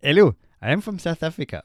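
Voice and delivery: loud, put-on South African accent. The roadman impression slipping into a loud South African accent. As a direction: announce yourself in a mock South African accent. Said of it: "it's gone South African".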